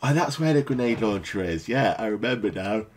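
talking through a big yawn; words stretched by a yawn. Real, not acted. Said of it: "Sorry for the yawn".

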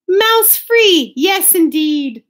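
high, loud cheer, then drawn out; triumphant. A narrator's reading. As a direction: crow it with relief. Bear delighted to find no mouse.

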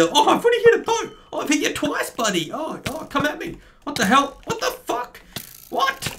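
very high, startled squeals; shocked outburst. Real, not acted. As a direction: react to the ambush. Ambushed by arrows.

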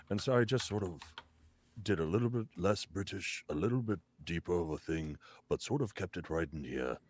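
put-on hushed, dramatic old wizard, kept small in the throat; wise old wizard. Shown as a demonstration. Describing his wizard voice for a casting, hushed old wizard. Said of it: "my wise old wizard voice".

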